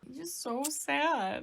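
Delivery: high, drawn-out sympathetic whine; pitying wail. Real, not acted. Fan gushing over a crying character, pitying wail. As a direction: draw it out high, full of pity.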